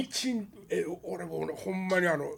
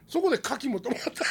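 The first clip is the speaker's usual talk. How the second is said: normal talking voice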